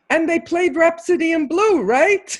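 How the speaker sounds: high, loud call across a room; excited yell. Retold or imitated. Concertgoer dashing backstage to the cellist, excited yell. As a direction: yell it out high and eager, breaking into a laugh. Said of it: "I yelled out".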